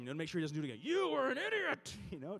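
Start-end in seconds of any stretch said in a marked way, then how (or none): 0.8-1.9 high, loud scolding of a dog; exasperated high-pitched telling-off; chastising. Retold or imitated. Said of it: "I'm trying to chastise him".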